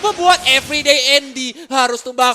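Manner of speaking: loud fast play-by-play; esports caster. Acted.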